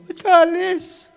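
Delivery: high wailing mock sob of a mother; hammed-up crying mother, high and loud. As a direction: wail it like a tearful mom. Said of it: "Bigla siyang umiyak".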